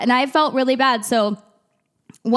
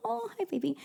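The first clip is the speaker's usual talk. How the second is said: much higher and much softer, a tender aside; 9 semitones higher, 14 dB quieter, faster than usual. Real, not acted. Aside, greeting the baby.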